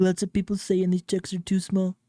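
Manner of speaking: lightly voiced Trump; a hint of Trump's cadence; Trump impression. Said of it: "that one was, wasn't as good".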